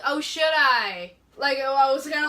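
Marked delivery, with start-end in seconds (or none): 0.0-1.1 drawn-out, raised call; slow, loud call to someone at a distance; shouting it over. Shown as a demonstration. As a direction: call it across slowly, louder and higher. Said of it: "shouting it over".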